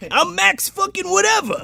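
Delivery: very high, brash comic caricature; Max's obnoxious voice. Retold or imitated. Driver introducing himself carelessly, mock obnoxious high voice. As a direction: shoot the pitch way up as a cocky loudmouth. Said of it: "This is how he actually sounds".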